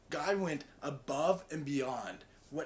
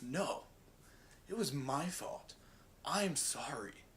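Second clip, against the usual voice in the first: slow and a little softer, long pauses; slow earnest apology; 4 dB quieter, slower than usual. Shown as a demonstration. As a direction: replay the stranger's deliberate, sincere sorry. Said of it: "Same inflection, same speed, everything".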